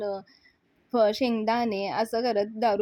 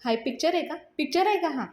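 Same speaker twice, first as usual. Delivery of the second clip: mildly irritated, second question higher; 5 semitones higher than usual. A narrator's reading. Raja snapping back at his friends' excuse, mildly irritated. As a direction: let the annoyance climb on the repeat. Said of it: "राजा चिडतो आणि म्हणतो".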